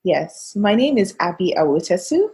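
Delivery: ordinary delivery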